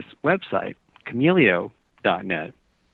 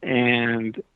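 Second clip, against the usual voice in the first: ordinary talk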